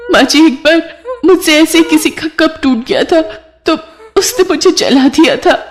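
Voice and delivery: very high, weepy frightened voice; tearful confession. Acted. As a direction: sob out a painful memory to mother-in-law. Frightened bride recounts being burnt. (रोती, सहमी बहू की ऊँची आवाज़)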